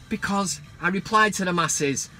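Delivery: ordinary delivery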